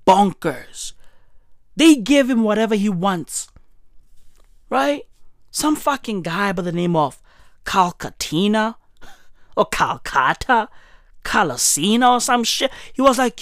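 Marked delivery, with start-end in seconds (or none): none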